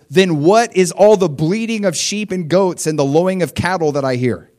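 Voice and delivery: a touch of prophet's demand; demanding Samuel. Acted. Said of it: "Samuel says".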